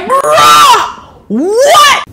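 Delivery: very high, squealed incredulous cry; hammed-up high-pitched outrage; mock disbelief. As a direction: shriek it in comic shock. Outraged disbelief at being silenced.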